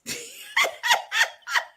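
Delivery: very high laugh; shrieking giggle; squealing laugh. Real, not acted.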